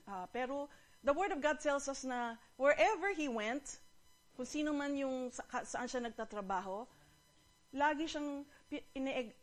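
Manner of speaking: ordinary talk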